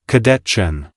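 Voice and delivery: cold, toneless computer announcement; automated voice. A narrator's reading. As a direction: read it like a machine PA system. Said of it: "The automated voice echoed ... of a tax audit".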